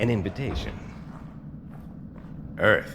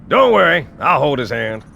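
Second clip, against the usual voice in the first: loud joking; 8 dB louder than usual. Acted. Sergeant joking loudly at Chief.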